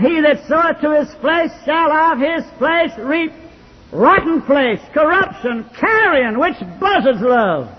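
hoch und kraftvoll gepredigt, am Ende langsam; preaching at full force. Acted. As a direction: ring it out like a revival preacher. Preacher quoting scripture with force.

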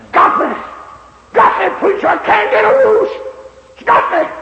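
high, loud, despairing wail; a broken man crying to the preacher; anguished cry. Retold or imitated. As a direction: cry out that it has him. Man despairing of his sins.

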